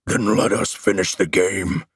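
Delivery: guttural monster voice; monster growl. A narrator's reading. Monster closing in after laughing, guttural growl.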